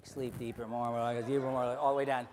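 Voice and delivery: low, soft hypnotist drone; hypnotic induction. Acted. Hypnotist to volunteers on stage, low soothing drone.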